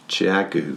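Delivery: Chiaogu with a flat "chag-oo" first syllable; unsure pronunciation. Shown as a demonstration. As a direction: try Chiaogu low, as "CHAG-oo". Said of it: "How do you say it?".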